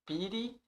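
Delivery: "Pity" with a tapped T, like "piry"; American pronunciation. Shown as a demonstration. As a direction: say "Pity" the American way, T as a quick flap. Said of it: "mais a pronúncia americana". ("Pity" com T fraco, à americana)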